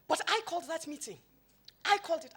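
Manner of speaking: loud, high stress on I called; grumpy protest. Retold or imitated. Grumpy tycoon protesting on the phone, loud with stress on I. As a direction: insist it's your meeting, annoyed. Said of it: "the grumpiest he's ever been".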